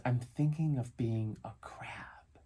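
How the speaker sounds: put-on slow whispery little-boy voice; hushed child imitation. Dad mimics his son visualizing, slow dreamy whisper. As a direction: whisper it slowly, lost in thought.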